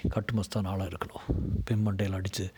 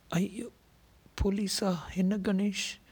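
dismayed woman; 11 semitones higher, slower than usual. A narrator's reading. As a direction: voice a woman alarmed at the news.

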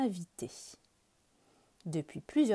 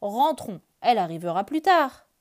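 bear voice; 8 dB louder than usual. Acted. Bear suggests going home.

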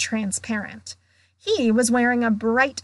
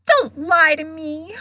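high, louder wounded cry; tearful gasp; 6 semitones higher, 5 dB louder than usual. A narrator's reading. Weeping ghost accusing them of lying, gasping. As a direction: burst out, hurt and accusing. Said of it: "Myrtle gasped".

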